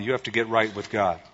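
ordinary talk

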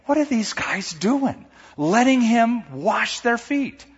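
baffled at what they are allowing; incredulous. Acted. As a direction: voice rising in appalled disbelief. Peter's imagined disbelief at the others.

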